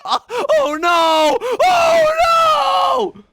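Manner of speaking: very high, loud drawn-out cry; panicked shout. Real, not acted. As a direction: scream in horror. Reacts to game disaster.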